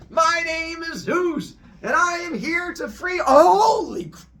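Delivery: very high, loud, slow heroic proclamation; grand announcement. Acted. Zeus proclaiming loudly to the hidden pit monsters. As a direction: announce yourself like a pompous hero.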